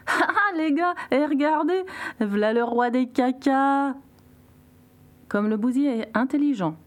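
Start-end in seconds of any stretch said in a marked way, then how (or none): 0.1-3.9 high-pitched, jeering monkey voice; mocking monkey. A narrator's reading. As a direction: crow it high, drawing out the last words to ridicule. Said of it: "un singe se mit à rigoler". (singe moqueur qui rigole)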